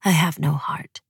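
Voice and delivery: unvoiced private whisper; whispered thought. A narrator's reading. Guest thinking to herself after goodnights, whispered. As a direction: whisper it as an inward vow.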